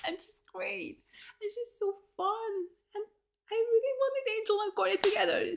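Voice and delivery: high voice, close to real crying; tearful. Real, not acted. Viewer mourning a couple that never happened, tearful. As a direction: choke up softly, pitch lifting. Tearful over a beloved character's death.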